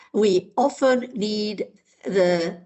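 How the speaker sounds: usual speaking voice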